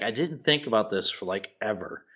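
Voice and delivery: ordinary delivery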